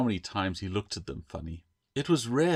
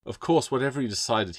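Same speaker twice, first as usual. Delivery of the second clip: usual speaking voice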